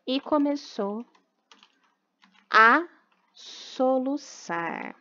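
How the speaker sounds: put-on slow, even reading for children to write down; slow dictation. Teacher dictating a rewritten story to the class, slow and deliberate. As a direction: read it out word by word so the class can copy it.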